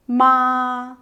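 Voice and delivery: "ma" held long, high and even, loud; high level tone. Shown as a demonstration. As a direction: sustain each "ma" on one steady pitch, like a hum. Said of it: "It's a high level tone".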